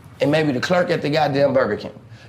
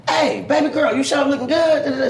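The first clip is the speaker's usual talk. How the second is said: shouted, much higher flirt across the street; shouted catcall; 11 semitones higher than usual. Retold or imitated. Pimp shouting a catcall at his ignoring woman.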